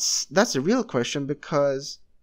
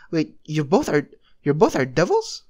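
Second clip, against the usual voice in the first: loud, stunned double-take; can't believe both of them are devils; super shocked; 6 dB louder than usual. Acted. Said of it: "the angel was super shocked and says".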